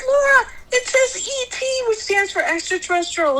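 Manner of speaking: high, pressing explanation; mother retelling how she pressed her daughter, high and urgent; insistent mom. Retold or imitated.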